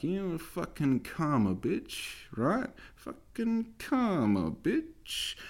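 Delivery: high, slow taunting sneer; mock her smug gloating. Imagined shop worker gloating at him, high slow smug taunt. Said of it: "I can feel her ... smiling".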